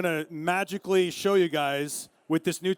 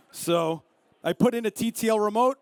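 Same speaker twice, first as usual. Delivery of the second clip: ordinary talk, nothing marked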